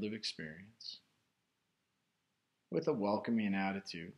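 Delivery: guided meditation. Real, not acted. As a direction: guide listeners inward, unhurried, phrase by phrase. Leads a guided meditation after chat.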